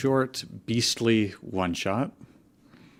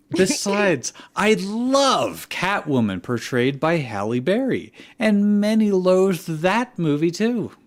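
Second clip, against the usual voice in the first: put-on high, loud, bubbly teen fanfic writer; gushing fan-author voice; 6 semitones higher, 5 dB louder than usual. Host voicing the fic author's note for the chat, high and loud with giggles. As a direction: read it like a giddy fan defending her movie.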